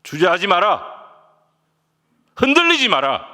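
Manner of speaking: loud, high, forceful order; firm command. Acted. Jesus answering the wavering disciple, firm loud command. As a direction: command it resolutely, building to a shout. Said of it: "단호하게 말씀하십니다".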